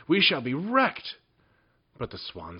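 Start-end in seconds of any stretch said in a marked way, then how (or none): 0.1-1.2 alarmed cry. A narrator's reading. Boat tossing in storm.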